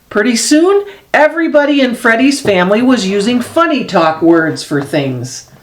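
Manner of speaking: usual speaking voice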